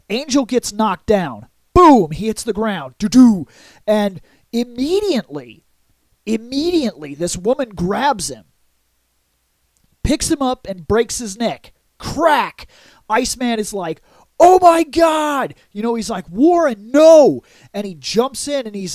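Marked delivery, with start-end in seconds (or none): 1.7-2.1 loud, high punched boom; mock impact boom. Comic-book narrator voicing Angel hitting the ground, punchy boom. Sound effect of Angel falling. 14.4-15.6 drawn-out, high shouted outcry; mock horrified shout. Iceman seeing his teammate's neck broken, mock horrified shout. 16.3-17.4 loud cry rising on the no; mock anguished shout. Iceman crying out to fallen Warren, mock anguished shout.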